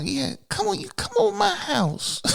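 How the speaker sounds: high squeaky imitation of a woman inviting him over; lightly voiced come-on; mock girl voice. Man mimicking a woman inviting him over, high and sing-song.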